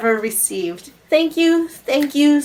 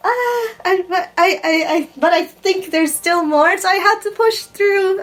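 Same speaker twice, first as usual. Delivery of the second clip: high, laughing through tears; half laugh, half cry, pushing on; real tearful laughter, higher and wavering; overwhelmed; 7 semitones higher than usual. Real, not acted. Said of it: "I'm just so overwhelmed".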